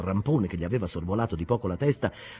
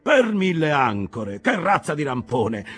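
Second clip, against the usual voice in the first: higher, louder rough skipper; hearty harsh captain; 8 semitones higher, 6 dB louder than usual. Acted. Captain Peleg welcoming them aboard, loud and hearty. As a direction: call out like an excited old salt. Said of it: "la voce aspra del capitano Peleg".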